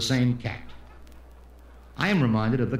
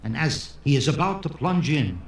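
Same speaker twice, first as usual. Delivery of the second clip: ordinary delivery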